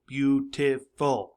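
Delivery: slow, separated "beautiful"; syllable by syllable. Shown as a demonstration. Teacher showing pupils the chin drops, "beautiful" syllable by syllable. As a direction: break "beautiful" into three slow beats. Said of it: "it's a syllable".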